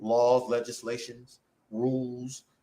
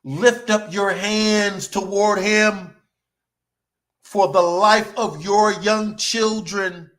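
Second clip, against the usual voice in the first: slow, louder, lifted exhortation; slow forceful preaching; 3 semitones higher, 6 dB louder, slower than usual. Acted. Preacher urging hands raised for the children, slow and loud. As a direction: draw each word out to command them.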